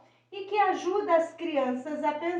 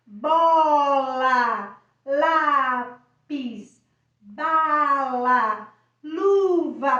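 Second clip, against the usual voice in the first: put-on loud, drawn-out words for a child to repeat; 7 dB louder, much slower than usual. Shown as a demonstration. Teacher modelling words for the child to repeat, loud. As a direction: give each word loudly for the child to echo. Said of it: "você vai fazer a criança repetir a palavra".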